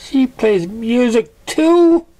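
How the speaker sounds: very high, loud, slow amazement; awestruck. A narrator's reading. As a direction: marvel at it breathlessly. Pinkie awestruck.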